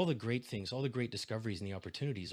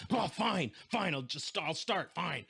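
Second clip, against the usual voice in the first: reluctant, put-upon sigh, higher; higher, exasperated mock engine giving in; grudging engine voice; 6 semitones higher, slower than usual. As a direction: give the engine a grumbling "fine, fine". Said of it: "you can hear the engine say".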